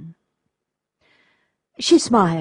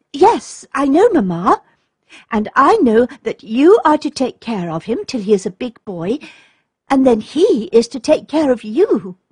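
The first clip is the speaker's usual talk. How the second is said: put-on high, louder voice of a young daughter; eager little girl; 5 semitones higher, 5 dB louder than usual. A narrator's reading. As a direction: answer brightly as the little girl. Leads daughter's eager reply.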